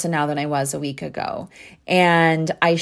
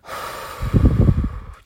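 releasing sigh. Retold or imitated. Releasing fear, long exhale.

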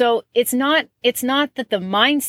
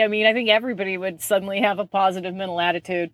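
ordinary talk

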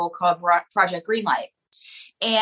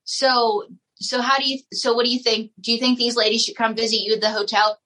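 ordinary talk

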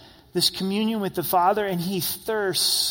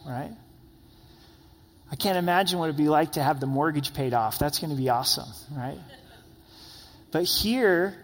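ordinary talk

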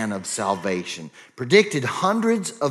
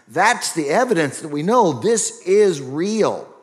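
fervent preaching; 5 semitones higher than usual. Acted. Preaching, pitch and level rising.

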